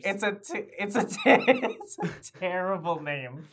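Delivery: loud laughing complaint. Real, not acted. Laughing at the name.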